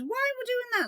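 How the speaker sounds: high mock voice of the husband; mimicking husband. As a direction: squeak his question up high.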